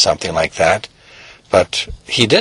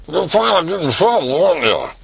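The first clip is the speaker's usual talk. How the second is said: accusing dad; 7 semitones higher than usual. Acted.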